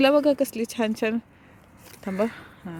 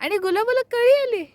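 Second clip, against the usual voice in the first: delighted; 6 semitones higher than usual. Real, not acted. Delighted at a rosebud.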